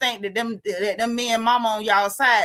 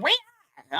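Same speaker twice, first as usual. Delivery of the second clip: mock scream; 10 semitones higher than usual. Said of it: "She screamed".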